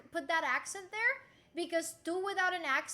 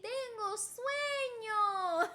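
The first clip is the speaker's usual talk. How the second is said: drawn out slowly, word by word; slow lesson demo; 4 semitones higher, much slower than usual. Shown as a demonstration. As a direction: teach it slowly. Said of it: "Can you repeat after me?".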